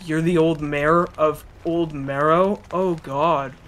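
ordinary talk

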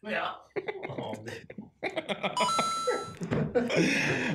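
long, helpless high laugh; real burst of laughter. Real, not acted. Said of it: "espriye güldüm ya".

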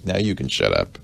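ordinary delivery

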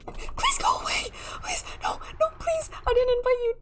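very high squeaky begging; panicked pleading. Pretend. Player begs an uninvited intruder to leave, high-pitched panic.

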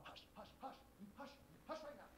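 whispered hush hush; urgent shushing. Acted. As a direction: hiss at him to be quiet. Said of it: "So all the while".

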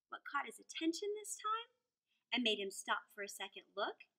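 ordinary delivery, nothing marked

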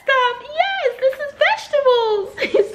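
put-on high squealy girl voice, slowing at the end; imitating excited Sydney. Retelling Sydney's joy at the produce, a high excited squeal. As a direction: squeal over the vegetables like a delighted kid. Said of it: "She was like".

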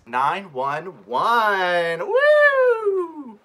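high excited whoop. Real, not acted. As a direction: hype it up with a whoop. Hyping up the song title.

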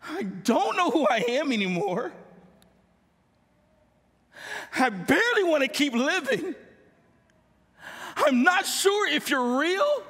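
pleading prayer that swells toward a cry; anguished prayer. Retold or imitated. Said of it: "I just cried out to God".

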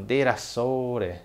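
"Derassore" very slow, each syllable stretched; slow drawn-out Guarani. Shown as a demonstration. Teacher drawls derassore for the class to copy. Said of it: "Seria bem essa expressão".

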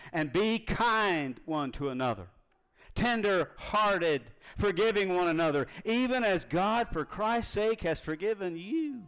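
slow, lifted reading of the verse; scripture reading. Acted. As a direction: draw out tender-hearted like a promise.

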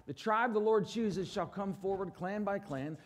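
usual speaking voice

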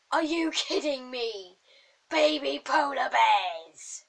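slow, drawn-out loud exclamation; amazed outburst. Real, not acted. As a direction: build to a loud cry of delighted disbelief. Discovers baby polar bears.

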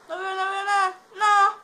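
high panicked yelling; panicked shout. Real, not acted. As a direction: yell it in real panic. Panic in-game, can't see.

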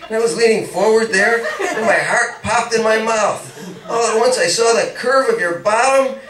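building from eager to breathless and high; rising rapture. Acted. Paul confessing to Peggy, building into breathless high rapture.

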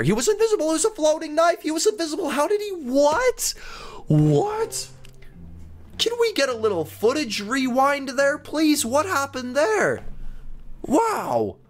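high, stunned disbelief; can't believe what just happened; shocked disbelief. Real, not acted. Gamer stunned that the murderer was invisible, shocked.

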